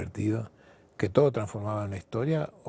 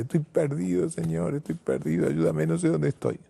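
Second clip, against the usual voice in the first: high, pleading lost sailor; a high, pleading voice crying to heaven; desperate plea; 6 semitones higher than usual. Acted. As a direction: beg for help like a man lost at sea. Lost sailor begging God for help.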